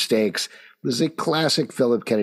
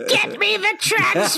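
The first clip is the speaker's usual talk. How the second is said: megalomaniac rant. Acted. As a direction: roar it like a cackling supervillain. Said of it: "You sound like fucking supervillain".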